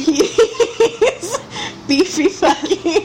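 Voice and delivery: high, giggly delivery; words squeezed out between laughs; laughing. Real, not acted. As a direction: talk through giggles. Giggling over the beefy character.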